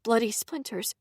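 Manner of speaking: ordinary delivery, nothing marked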